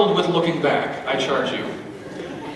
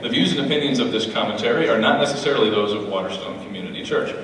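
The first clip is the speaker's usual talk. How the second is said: fast, lower legal disclaimer; quick soft mock disclaimer; disclaimer read; 6 semitones lower, 3 dB quieter, faster than usual. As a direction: rattle it off like fine print.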